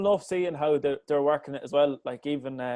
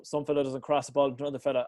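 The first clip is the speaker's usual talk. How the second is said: ordinary talk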